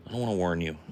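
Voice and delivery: ordinary delivery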